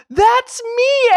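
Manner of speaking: high, loud comic holler; retold yell. Runner answers final boarding call, hollering high and loud. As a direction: yell it out, re-enacting the moment. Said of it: "And I yell".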